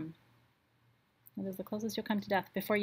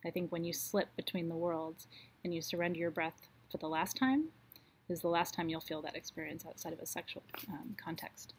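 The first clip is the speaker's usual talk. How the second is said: ordinary talk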